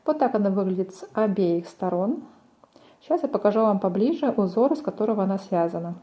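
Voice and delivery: ordinary delivery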